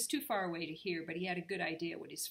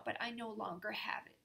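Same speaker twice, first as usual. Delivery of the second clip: whisper; 3 semitones higher than usual. Acted. Peabody nervous confession.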